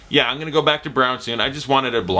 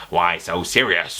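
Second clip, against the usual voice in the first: Joker; 5 semitones lower, slower than usual. Retold or imitated. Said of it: "It's a monologue".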